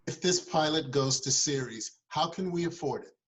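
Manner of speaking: a touch of an incredulous money man; skeptical board member. Acted. Board grills the producer.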